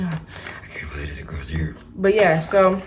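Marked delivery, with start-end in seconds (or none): 0.0-1.8 muttered disbelief. Retold or imitated. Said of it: "I was like".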